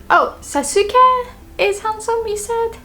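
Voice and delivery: high, puzzled question; mock confused. Shown as a demonstration. Friend who misheard, asking back in high mock confusion. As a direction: play someone who misheard the name. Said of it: "sounds like she wasn't listening to me".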